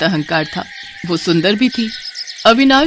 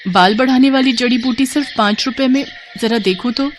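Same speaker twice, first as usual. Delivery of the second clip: higher-pitched wondering question; intrigued; 5 semitones higher than usual. Acted. Bride hears the herb seller's offer, intrigued. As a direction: echo the offer with curious interest.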